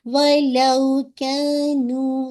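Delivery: وَلَوْ with the و stretched almost two counts, slow; two-count waw leen. Shown as a demonstration. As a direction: draw out وَلَوْ about two beats. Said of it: "آپ نے اس کو کتنا count پڑھنا ہے؟ Almost ٹو". (وَلَوْ میں واو لین کو تقریباً دو حرکت کھینچ کر)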